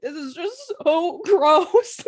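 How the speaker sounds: high, drawn-out whine breaking into laughter; laughing disgust. Retold or imitated. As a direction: whine it slowly through a laugh. Retold disgust at the street.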